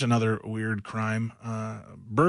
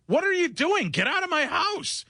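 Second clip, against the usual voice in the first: much higher, shrill panicked cry; mock panicked shout. Host reading the woman's quote, in a panicked high yell. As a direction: play the woman screaming at the intruder. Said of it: "she said".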